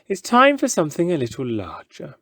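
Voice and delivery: grand ringmaster announcing to the crowd; showman voice. Acted.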